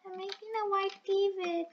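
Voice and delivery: high, soft little singsong; high playful voice. Pretend. Player announcing a video, in a high soft voice. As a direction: say it high and softly. Repeats line in contrasting voices.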